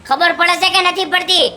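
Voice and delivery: demanding where she went; angry interrogation. Acted. Husband scolds returning wife. (ગુસ્સામાં સવાલ પૂછવો)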